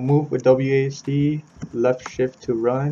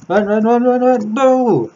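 high, loud panicked yelling; panicked screaming; 10 semitones higher, 7 dB louder than usual. Real, not acted. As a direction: scream as you flee in terror. Caught by the homeowner.

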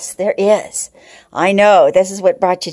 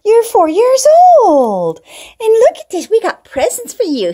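sing-song voice for a beloved pet; pet talk; 10 semitones higher, 4 dB louder than usual. Real, not acted. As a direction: coo up high at the birthday kitty. Birthday talk to pet cat.

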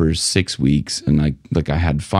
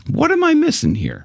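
frustrated. Retold or imitated. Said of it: "I was going".

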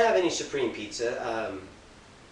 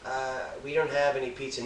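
repeating it quieter; 4 semitones lower, 10 dB quieter than usual. Acted.

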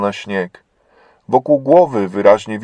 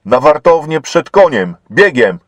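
loud, higher command; shouted order; 5 semitones higher, 5 dB louder than usual. A narrator's reading. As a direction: drive her off at a run. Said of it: "krzyknął na towarzyszkę".

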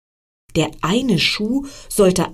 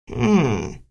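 frog pondering; 6 semitones lower than usual. Acted. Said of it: "sagte der Frosch".

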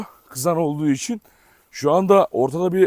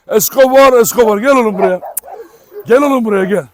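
loud, high call to come here; calling the dog; 4 semitones higher, 7 dB louder than usual. Real, not acted. Owner calling Escobar to come, loud high call. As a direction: shout him over, eager and firm.

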